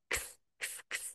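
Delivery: very soft voiceless "খিস"; ear-whisper. Shown as a demonstration. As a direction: whisper each one like a secret in the ear. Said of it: "কানে কানে গিয়ে কথা বলা". (হালকা ফিসফিস করে)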